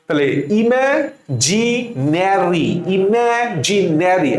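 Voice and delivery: ইমাজিনারি drawn out slowly as ইমাজিনেরি; slow spelling drill. Shown as a demonstration. Teacher drilling the pronunciation with students, slowly. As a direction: stretch ইমাজিনারি out. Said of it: "উচ্চারণ করবো কিভাবে".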